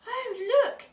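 high, loud burst of wonder; acted delighted surprise; excited discovery. A narrator's reading. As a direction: exclaim like a child spotting treasure. Otto spots otter in pearl.

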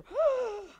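sharp high astonished gasp. Retold or imitated. Preacher acting out the healed man's gasp of amazement. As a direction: gasp in wonder at the miracle.

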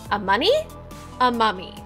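usual speaking voice

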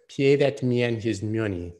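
doing the Rounders movie line in character; movie quote voice. Shown as a demonstration. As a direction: deliver it like the Rounders card player. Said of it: "Another movie quote, but in the proper voice".

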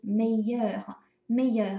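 meilleur said slowly, each syllable clear; teacher pronouncing meilleur slowly; slow clear French. Shown as a demonstration. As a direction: model meilleur slowly for learners. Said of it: "更好的呢是meilleur".

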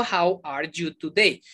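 "How are you today?" slow and unlinked; word by word. Shown as a demonstration. Teacher contrasting what not to say, slowly word by word. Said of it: "Yo no digo".

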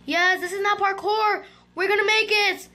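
high, elated exclamation; excited cheering. Real, not acted. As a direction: cheer with rising hope. Celebrates a map without parkour.

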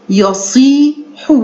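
يصيح slowly, long "see" in the middle; ya-seee-h, stretching the middle of يصيح; slow model pronunciation. Shown as a demonstration. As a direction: model يصيح at half pace. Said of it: "مع حرف المد الطويل".

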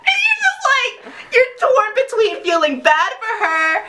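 talking through high giggles; laughing. Real, not acted. Vlogger laughing retelling the whip scene, real giggles. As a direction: giggle while you say it.

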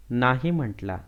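usual speaking voice

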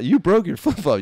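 "You" punched high and loud; stress on YOU. Retold or imitated. Clerk blaming the customer for her flip-flop, "You" stressed high. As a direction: throw the blame onto "You", laughing. Clerk contrasts who broke it.

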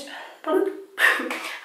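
short high giggle at a slip; embarrassed laugh. Real, not acted. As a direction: laugh off the mistake.

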